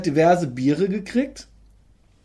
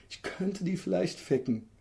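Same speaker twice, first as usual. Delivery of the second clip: thought to yourself; 13 dB quieter than usual. Retold or imitated. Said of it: "und du denkst".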